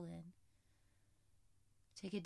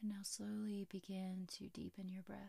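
soft spa whisper; 16 dB quieter than usual. Acted. Whispered closing guidance.

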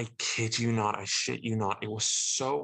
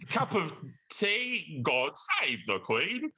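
slow, higher, hammed-up fake British accent; bad British accent; 8 semitones higher, slower than usual. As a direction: drag out a cartoonish British accent, high and slow. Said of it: "did not have good British accents".

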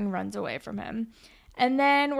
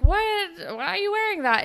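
high, louder startled question; acted disbelief; 10 semitones higher, 3 dB louder than usual. Claire questions Allie's parent clothing, acted disbelief. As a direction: imitate a taken-aback friend. Retelling Claire's reaction to outfit.

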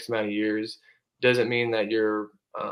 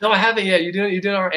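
excited; 9 semitones higher, 6 dB louder, faster than usual. Retold or imitated. Coach retells his own reply.

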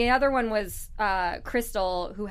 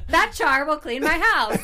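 louder, higher, playful demo line; hammed-up example sentence; 5 semitones higher, 6 dB louder than usual. Shown as a demonstration. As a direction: sell the sentence with gusto. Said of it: "you gotta be better than that one".

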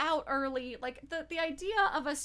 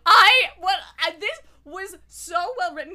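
overwhelmed; 7 semitones higher, 15 dB louder than usual. Real, not acted. Overwhelmed by the book.

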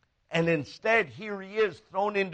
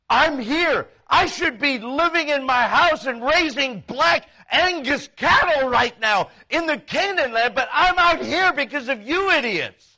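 raised, louder indignant rant; worked-up grievance, pitched up; exasperated griping; 6 semitones higher, 5 dB louder than usual. Acted. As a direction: complain bitterly at the ones to blame. Acts Caleb griping at idiots.

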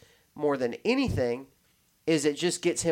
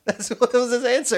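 laughing; 9 semitones higher, 7 dB louder than usual. Real, not acted. Laughing at guest's answer.

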